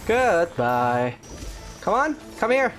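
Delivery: ordinary delivery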